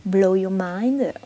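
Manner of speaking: put-on slow, deliberate English accent on the title; mock English accent. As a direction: show off a self-mocking English accent. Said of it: "avec mon super accent". (accent anglais exagéré pour rire)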